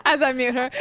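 laughing. Real, not acted. Laughing while muting cohost.

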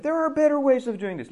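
high, pointed complaint; acted complaint. Shown as a demonstration. Teacher to students, voicing a complaint in a high voice.

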